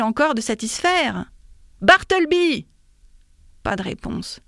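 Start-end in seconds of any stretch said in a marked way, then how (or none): 1.8-2.7 loud, high call for someone; raised-voice call. A narrator's reading. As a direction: summon him sharply from across the office. Narrator calls out, first time.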